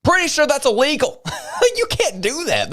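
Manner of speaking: laughs it out, high and incredulous; half-laughing, can't believe it; laughing disbelief. Real, not acted. Vlogger laughing in disbelief at the clip, high and incredulous.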